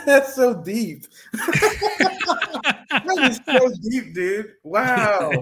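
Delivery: loud, high, laughing wonder; awed amazement. Real, not acted. Co-host reacting to the viewer's insight, amazed. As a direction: let it burst out, genuinely blown away.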